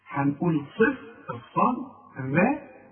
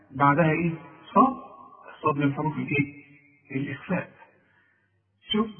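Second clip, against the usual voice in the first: ordinary talk, nothing marked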